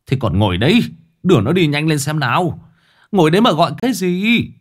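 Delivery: loud, high, rising into a shout; angry bark. A narrator's reading. Old uncle yelling at the parents to take the boy, angry bark. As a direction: snap them into action. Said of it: "bác Phước quát".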